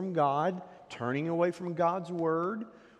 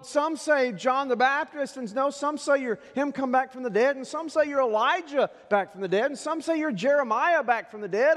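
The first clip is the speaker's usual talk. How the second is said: raised, impassioned preacher voice; higher and louder pulpit delivery; fervent preaching; 9 semitones higher, 7 dB louder than usual. Acted. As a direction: preach it out big to the congregation. Preaching at full force.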